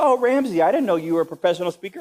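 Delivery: high, surprised woman's voice; a lady suddenly impressed. Retold or imitated. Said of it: "She said".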